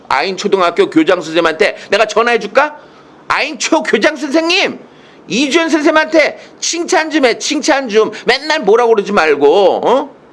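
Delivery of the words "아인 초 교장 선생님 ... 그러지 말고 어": mock phone demand. Calls the principal, mock demand.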